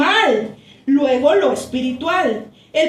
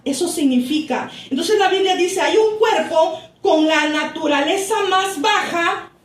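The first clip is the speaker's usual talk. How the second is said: normal talking voice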